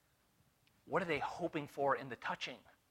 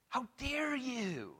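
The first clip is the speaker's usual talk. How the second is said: slow, hushed indignant rebuke; 8 semitones higher, 4 dB quieter, much slower than usual. Retold or imitated. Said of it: "he was indignant".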